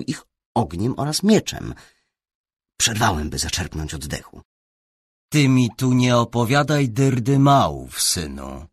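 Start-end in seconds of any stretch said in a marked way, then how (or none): 5.3-8.7 measured, firm rebuke from a bishop; deliberate. A narrator's reading. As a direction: deliberately put him in his place, louder. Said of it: "rzekł powoli biskup". (powoli, z naciskiem)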